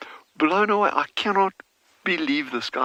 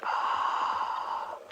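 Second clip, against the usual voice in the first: awed gasp; 6 dB quieter than usual. Real, not acted. Sees the ducklings.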